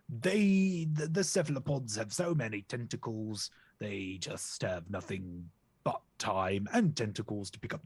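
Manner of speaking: posh crocodile voice; plummy upper-class English; posh accent. Acted. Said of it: "I'm going posh again".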